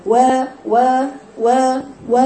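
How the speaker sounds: short و repeated without rounding the lips; clipped-off و syllables with slack lips; unrounded damma. Retold or imitated. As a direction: mimic a student's lax و, lips not pursed. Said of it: "ينطق الحرف المضموم دون أن يضم شفتيه".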